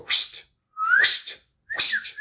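airy whooshing whistle. Shown as a demonstration. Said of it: "This is how I whistle".

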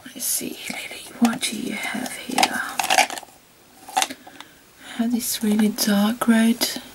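soft unvoiced whisper. Real, not acted. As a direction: whisper to yourself. Whispering while choosing lipstick.

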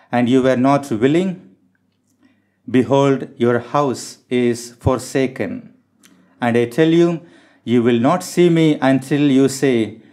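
ordinary talk, nothing marked

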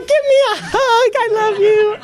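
very high imitated squeal; excited squeal. Small sister runs up to the preacher for a hug, imitated excited squeal. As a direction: mimic her in a tiny, high-pitched voice.

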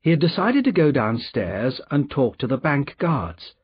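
usual speaking voice

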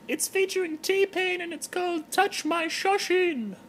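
Terry the giraffe. Acted. Terry the giraffe greeting her blog fans in falsetto. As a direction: greet the fans like a tipsy celebrity in a high voice.

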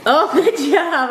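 slow, laughing praise for dog. Real, not acted.

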